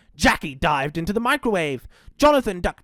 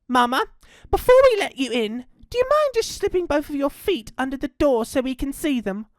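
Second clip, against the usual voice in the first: put-on higher, polite child's voice; joey calling out; 7 semitones higher than usual. A narrator's reading. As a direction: call to mama in a pitched-up little girl's voice. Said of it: "she called out".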